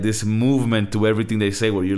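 ordinary delivery

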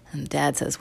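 plain reading voice

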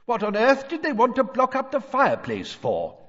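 high, loud, puzzled question; baffled calling. A narrator's reading. Mister Weasley behind the boards, calling back baffled. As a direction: call back in bewilderment, pitched high.

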